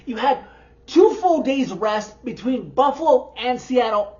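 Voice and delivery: usual speaking voice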